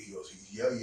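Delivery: usual speaking voice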